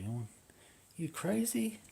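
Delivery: very high, squeaky disbelief; incredulous. Retold or imitated. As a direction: ask it in high-pitched astonishment. Retold disbelief at police accusation.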